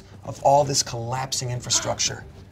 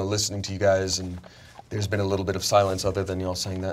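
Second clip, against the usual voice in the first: ordinary talk, nothing marked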